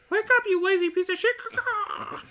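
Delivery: high parrot squawk with caws; piercing cartoon parrot voice; Polly. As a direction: screech it like a scolding bird. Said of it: "thing that Polly would say".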